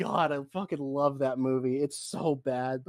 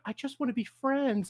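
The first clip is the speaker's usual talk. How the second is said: puppy-dog pleading; 7 semitones higher than usual. Retold or imitated. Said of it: "gives, like, the puppy dog eyes of".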